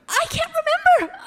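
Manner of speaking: frustrated outburst. Acted. Mother frazzled.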